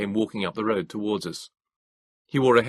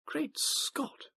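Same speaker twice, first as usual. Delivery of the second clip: put-on high, breathy whisper of an old gentleman; hushed high whisper of amazement; Moses whispering; 8 dB quieter than usual. A narrator's reading. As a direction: whisper it in awe. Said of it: "Moses whispered to me".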